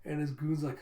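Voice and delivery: ordinary talk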